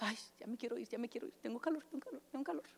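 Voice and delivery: mock churchgoer whispering about the heat; whiny whisper. Mocking whiny hot churchgoer.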